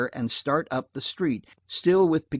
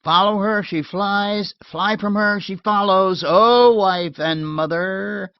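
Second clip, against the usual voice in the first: loud, higher teasing call; calling through door; 8 semitones higher, 8 dB louder, slower than usual. Acted. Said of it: "I called".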